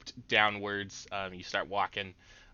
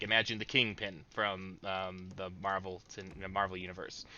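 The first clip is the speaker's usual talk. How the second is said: ordinary delivery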